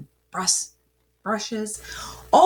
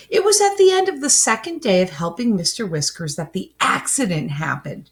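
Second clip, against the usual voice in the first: plain reading voice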